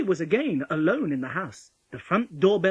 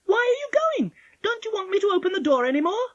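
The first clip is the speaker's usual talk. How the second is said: put-on very high, loud child; Polly, calling after wolf; 7 dB louder than usual. A narrator's reading. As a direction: call out questions, pitched way up.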